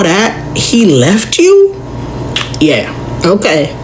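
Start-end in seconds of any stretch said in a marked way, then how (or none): none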